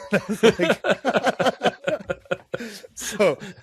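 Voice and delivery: high, loud, talking through laughter; laughing. Real, not acted. Cohost amused by a player's answer, laughing. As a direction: let real laughter spill into the words.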